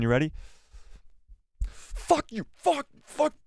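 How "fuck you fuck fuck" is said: very high grumbling droid voice; short high bursts like a cursing robot; Chopper impression. Streamer showing chat an impression, sweary Chopper droid voice. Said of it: "Here's my Chopper impression".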